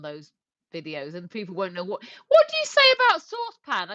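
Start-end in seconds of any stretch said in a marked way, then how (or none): 2.3-3.9 louder question. Real, not acted. Straining to catch a word.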